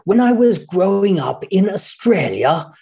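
put-on high, slow, plummy British narrator accent; mock a very British audiobook narrator; posh British accent. Mocking the publisher's British narrator in a posh British accent. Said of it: "the most British sounding person that you could possibly hire".

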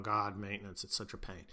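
low, quiet put-upon groan; grumbling soldiers. Retold or imitated. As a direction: mumble it like a bored soldier. Soldiers grumbling about commander's priority.

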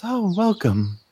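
high, lilting dryad professor voice; a melodic forest spirit greeting students; sing-songy dryad. Acted. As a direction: let the lines float up and down like a song. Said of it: "almost in a sing-songy voice says".

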